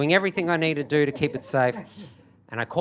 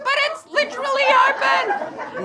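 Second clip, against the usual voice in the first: hysterical, hammed-up screech; frantic protest; 4 dB louder than usual. Late passenger insisting to gate attendant, high frantic screech. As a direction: squeal it very high in disbelief.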